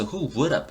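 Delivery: "would have" blended into "wour-ab" after "Who"; linked pronunciation. Shown as a demonstration. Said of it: "이렇게 발음돼". ("would have"를 "워럽"으로 연음)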